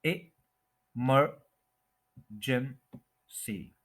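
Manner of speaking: Emergency very slowly as i... mer... jen... si; emergency spelled out in sound, long pauses between syllables. Shown as a demonstration. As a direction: break emergency into four separate syllables. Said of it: "second syllable पे stress है".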